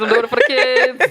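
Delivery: laughing mock excuse. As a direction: laugh through the line. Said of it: "But Brisky love the kids".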